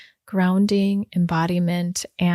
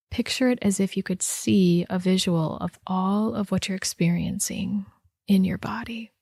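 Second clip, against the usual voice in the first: unhurried body-scan guidance with long pauses; guided meditation. Real, not acted. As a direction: guide listeners gently inward, leaving space after each instruction.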